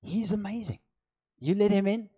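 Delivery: normal talking voice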